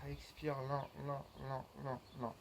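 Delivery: hushed, separated repeats; soft pacing cue. Shown as a demonstration. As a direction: softly measure out each word to pace the breath. Coach pacing a slow exhale.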